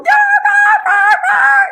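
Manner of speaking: loud, very high alarm beeps; piercing repeated beep imitation; imitated beeper. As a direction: blare like a parking sensor. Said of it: "As the beep sign is going".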